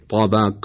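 emphatic, dark T in «طبق»; تلفظ ط با اطباق. Shown as a demonstration. («طبق» با طای پرحجم و مُطبَق)

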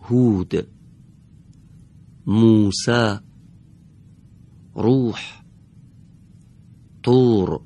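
each word with a long, drawn-out opening oo; deliberate model reading, long u held; long damma. Shown as a demonstration. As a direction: stretch the first vowel into a full Arabic oo. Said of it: "حرف اولشون دارای ضمه کشیده هست".

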